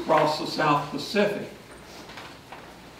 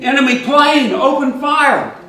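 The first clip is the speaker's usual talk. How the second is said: panicked scream, much higher and louder; 11 semitones higher, 11 dB louder than usual. Retold or imitated. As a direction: scream the alarm in terror. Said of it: "the J G started screaming".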